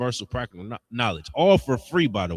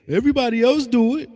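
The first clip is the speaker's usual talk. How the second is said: much higher whiny mock voice; mocking excuse; 11 semitones higher, 3 dB louder, faster than usual. Preacher imitates people excusing their habits, high mocking whine. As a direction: ape their lame excuse. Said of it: "they say".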